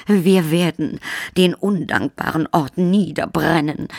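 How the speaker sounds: high, groaning vampire countess. Acted. Countess groans as she lands heavily. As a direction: moan the threat as the countess. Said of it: "stöhnte die Gräfin".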